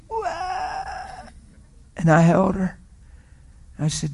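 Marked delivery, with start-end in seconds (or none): 0.1-1.3 high, thin mock bawl; imitated child's wail. Daughter breaking down at dad's apology, imitated high wail. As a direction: imitate a little girl bursting into tears. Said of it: "she went".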